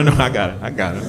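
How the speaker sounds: ordinary delivery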